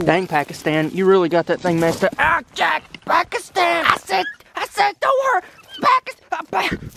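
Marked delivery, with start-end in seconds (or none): 2.2-6.9 high, frantic yell at the dog. Real, not acted. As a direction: yell his name, alarmed. Dog fighting him, yelling at dog.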